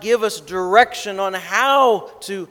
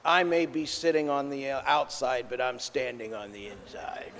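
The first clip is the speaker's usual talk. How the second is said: soft sulky grumble, getting softer; under his breath; 5 semitones lower, 11 dB quieter than usual. Retold or imitated. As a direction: mutter it defiantly, barely audible. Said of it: "he says under his breath".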